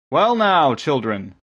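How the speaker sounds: high, loud hail falling away; calling out. A narrator's reading. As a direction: call out to children in a boat. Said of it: "Mister Breek called out to the boat".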